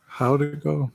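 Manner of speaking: usual speaking voice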